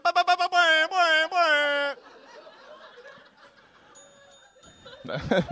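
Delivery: very high, loud vocal air horn; rapid stutter into long honking blasts; imitated horn blasts. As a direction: blare it out like a celebratory horn. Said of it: "Then she hit a horn".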